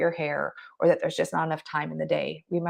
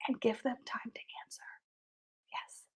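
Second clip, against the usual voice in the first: whispered; 3 semitones higher, 15 dB quieter than usual. Real, not acted. Coaching on the power of pause.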